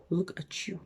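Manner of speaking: soft, muttered disapproving aside; quiet disapproval. Retold or imitated. Scornful reaction, first quiet take.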